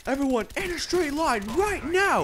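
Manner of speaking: high, loud yell imitating a drill-strict teacher; strict barked order. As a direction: shout the class into line, voice way up. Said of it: "really strict about it".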